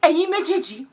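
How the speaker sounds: high, loud shouting; furious mum. Retold or imitated. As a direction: imitate a mum ordering them out. Mum throwing them out.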